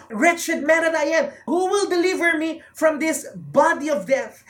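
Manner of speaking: Paul crying out in despair; cry of desperation. Acted. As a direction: cry out for rescue. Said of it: "the cry of desperation of Paul".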